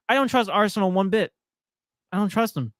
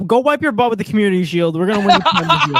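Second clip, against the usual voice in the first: cocky Pep voice; 4 semitones higher, 7 dB louder than usual. Retold or imitated. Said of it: "he's like".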